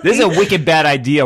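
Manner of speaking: put-on a playful Boston accent said with a grin; Massachusetts accent. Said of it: "Massachusetts accent".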